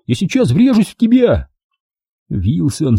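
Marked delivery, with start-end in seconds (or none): 0.1-1.5 very high warning cry, little extra volume; alarmed shout. A narrator's reading. Jack is about to crash into Wilson, high alarmed cry. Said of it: "закричал я".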